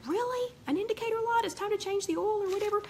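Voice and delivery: mock annoyance at the car; irritated mock whine. Voicing irritated driver.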